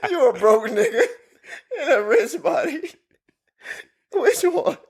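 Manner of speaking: high-pitched question broken by laughter; laughing hard. Real, not acted. Host asking if friend is rich or broke inside, laughing hard. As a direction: struggle through the question while cracking up.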